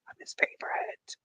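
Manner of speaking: unvoiced conspiratorial whisper. Host whispering a secret to her stream chat. Whispered aside contradicting herself.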